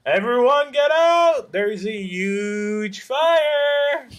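high, loud warning cry, last words stretched; mock alarm shout. Player acting out a warning about a huge fire, high drawn-out shout. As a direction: holler a fire warning to a whole crowd.

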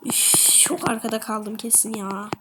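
ordinary talk, nothing marked

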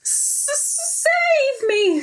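high, frightened stutter. Acted. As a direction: stammer for help, terrified. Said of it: "stuttered Pear".

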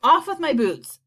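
loud panicky order; shout. A narrator's reading. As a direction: shout it out urgently. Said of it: "shouted the centipede".

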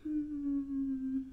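ordinary talk

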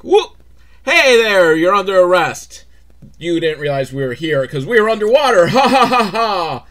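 loud, high, smug gloating with a laugh; mock gloating cop. Police surfacing to arrest the fisherman, gloating. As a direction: crow over the catch.

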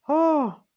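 high, loud mocking "oh"; retold shriek. The witness imitates the medium's shriek for the court, high and loud. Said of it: "y luego gritó otra vez".

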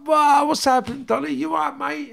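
imitating his own overjoyed call to a mate; happy greeting. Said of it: "I pulled up all happy to see him".

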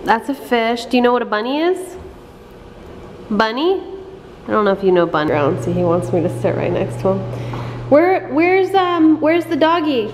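sing-song picture book questions; reading to a toddler. Acted. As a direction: coax the baby to point things out.

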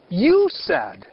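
You said with You hit high and loud; stress on you. Shown as a demonstration. Teacher translating dixisti for the class, stressed You. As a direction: punch You in You said. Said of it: "but dixisti".

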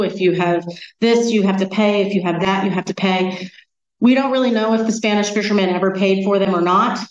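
usual speaking voice

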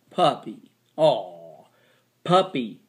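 "puppy" ending in a clear long E; teacher sounding out "puppy", final y as ee. Shown as a demonstration. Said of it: "That's E, long E sound".

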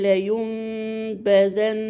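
drawn-out Quranic demo of "لا ينبذن", humming "mm" before the ba; iqlab with ghunnah. Shown as a demonstration. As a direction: recite "لا ينبذن" slowly, noon as a long hummed meem, ba pressed clearly. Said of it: "هنا هتجيبي غنة في الميم".

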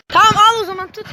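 normal talking voice

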